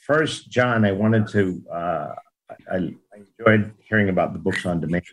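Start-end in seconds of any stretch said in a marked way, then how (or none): none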